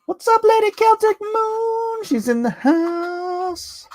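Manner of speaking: very high, sing-out falsetto, last word drawn out; playful falsetto greeting. Pretend. Host greeting Lady Celtic Moon as she arrives, playful falsetto. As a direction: hail them in a silly squeaky-high voice.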